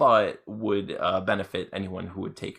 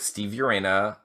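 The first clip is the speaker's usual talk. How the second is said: ordinary talk, nothing marked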